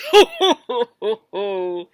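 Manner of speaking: big booming laugh; loud rolling laughter. Acted. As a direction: laugh out loud, full chest. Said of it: "Then he laughed out loud".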